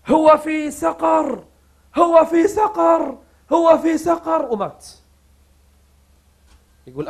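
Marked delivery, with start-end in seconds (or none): 0.1-4.5 high, slow, drawn-out cry of a dying man; dying man's cry. Retold or imitated. A dying man cries out as he dies, slow and high. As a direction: stretch each word out high and slow like a last breath. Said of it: "قال كلمة هو ما عرف ايش معناتها".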